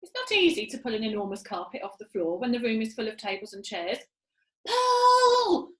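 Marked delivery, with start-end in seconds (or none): 4.7-5.7 loud, high yell. A narrator's reading. Monkey father yelling at the pullers, loud high yell. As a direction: yell the command. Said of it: "yelled Muggle Rump".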